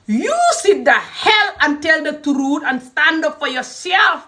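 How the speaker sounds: high, slow, emphatic scolding; impassioned exhortation. Real, not acted. Speaker urges listeners to stand up for themselves, impassioned exhortation. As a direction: urge it out, stretching the words.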